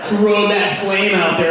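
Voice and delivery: ordinary talk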